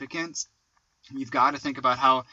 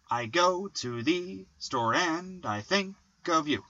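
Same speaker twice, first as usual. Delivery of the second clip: slow sing-song iambs, leaning on GO, TO, STORE; iambic stress; 3 semitones higher, 3 dB quieter, slower than usual. Shown as a demonstration. Poet demonstrating iambs to co-host, iambic stress. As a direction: stress every second word: "i GO to THE store AND". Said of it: "unstressed, stressed".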